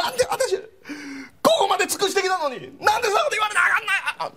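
high, wailing woman's voice; tearful woman. Retold or imitated. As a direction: cry out as the betrayed girlfriend. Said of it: "女の人がもう泣き出した". (泣きながら訴える女性の声)

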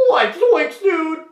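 very high, loud cartoon yelp; character voice. Shown as a demonstration. Showing off voices for fan question, high loud cartoon character. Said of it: "when I do that voice".

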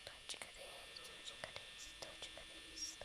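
low eerie unvoiced whisper; creepy whispered Toçka değil. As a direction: whisper it like a ghost.

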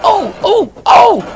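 very high, loud squealing cries; silly high truck voices; crying trucks. A narrator's reading. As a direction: yelp as you're bumped. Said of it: "cried the trucks".

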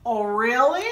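ordinary delivery

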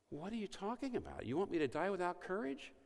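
a gangster balking at a shameful death; indignant disbelief. Retold or imitated. Gangster indignant at priest's request.

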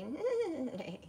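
high, wavering horse whinny on the word; neighing. A narrator's reading.

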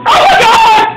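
angry shouting. Real, not acted. Laughed at while losing.

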